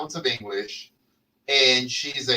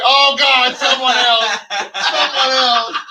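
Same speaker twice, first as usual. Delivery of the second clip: panicked realization; 8 semitones higher, 7 dB louder, slower than usual. Retold or imitated. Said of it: "and I'm like".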